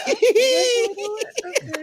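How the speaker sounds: very high, drawn-out squealed reaction; giddy high-pitched okay, okay; excited squeal. Retold or imitated. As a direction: squeal it high and stretched, half laughing. Acting out own excited reaction.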